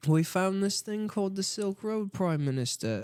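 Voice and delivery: high-pitched Brit reporting a discovery; put-on colonial scout; mock British underling. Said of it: "'cause they were like".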